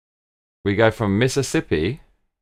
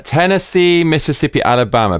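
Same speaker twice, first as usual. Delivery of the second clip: excited listing; 7 semitones higher, 4 dB louder than usual. Real, not acted. Excitedly lists southern states.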